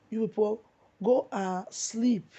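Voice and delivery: loud, slow, scornful send-off; loud dismissal. Real, not acted. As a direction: tell them off loudly, stretch "go and sleep". Scolding critics who rejoiced at death.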